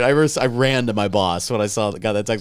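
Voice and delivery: normal talking voice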